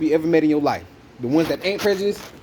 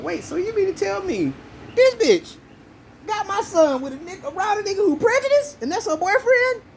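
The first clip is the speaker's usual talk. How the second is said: much higher, rising disbelief; incredulous outrage. Retold or imitated. Telling his friends how he reacted, high and incredulous. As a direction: squeal it in shocked disbelief. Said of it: "And I'm like".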